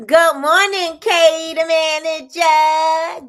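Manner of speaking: high, loud, slow sing-song call; loud drawn-out greeting. Pretend. Calling good morning to Kay the manager, loud and drawn out. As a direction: stretch the greeting out like calling across the room.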